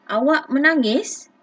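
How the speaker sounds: sung-out question intonation; questioning rise. Shown as a demonstration. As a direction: lift the end like a real question. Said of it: "intonasi suara kita pun, okey, ada lenggok dia".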